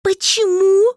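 high, loud protesting cry; indignant why. A narrator's reading. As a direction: shout out in dismay. Heroine cries out. (возмущённый крик, высоко и громко)

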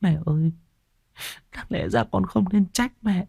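high, soft tearful pleading; small high voice choked with remorse. Acted. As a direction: plead softly through tears, very high. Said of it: "cô Loan quỳ bên cạnh cũng mếu máo".

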